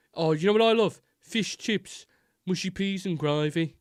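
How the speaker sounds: high-pitched, exaggerated Brummie; Brummie accent. Donut seller sharing his favourite chippy order, strong Brummie accent. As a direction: imitate a chatty Birmingham chippy fan. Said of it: "like, the strongest Brummie accent ever".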